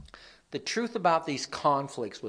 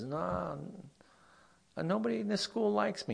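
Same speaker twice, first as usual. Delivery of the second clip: higher-pitched, mildly upset retelling; mock tearful student; 9 semitones higher than usual. New student complaining to the preacher at school, mildly tearful. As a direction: lightly voice a crying student's complaint. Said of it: "came crying to me one time".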